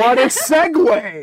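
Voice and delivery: laughing, high and loud. Real, not acted. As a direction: cackle the words out slowly. Laughing at awkward transition.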